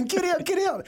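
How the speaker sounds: hammed-up panic yell; mock urgent yell. Retold yelling to family on boat.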